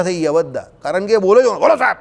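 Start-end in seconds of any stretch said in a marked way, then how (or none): 1.4-2.0 raised, confident peon answer; high-pitched bold reply. Retold or imitated. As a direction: speak as if from the top note. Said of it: "પહેલે મારાથી વાત કરતો હોય ને એવી રીતે બોલે".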